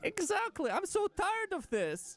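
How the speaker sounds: high, slow cartoon sigh; weary squeaky cartoon voice; cartoon character voice. Real, not acted. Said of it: "you sound like a damn cartoon character".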